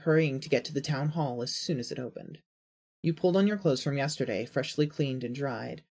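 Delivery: usual speaking voice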